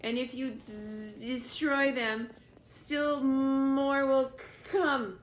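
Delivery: slow, broken, stammering machine voice; dying robot warning Roz, slow and stuttering; garbled dying robot. Acted. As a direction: force out each word as the circuits fail. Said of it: "in a quiet, garbled voice".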